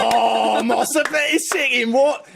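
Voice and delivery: high, drawn-out mock British; fake British gibberish. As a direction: stretch the nonsense in a hammed-up British accent. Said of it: "we called it being British".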